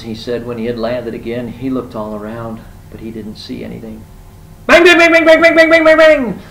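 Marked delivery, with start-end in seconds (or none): 4.7-6.4 very loud, high rapid-fire bangs; machine-like burst of high, shouted bangs; banging noise. Acted. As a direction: hammer the word out loudly, high-pitched. Acts out the banging again.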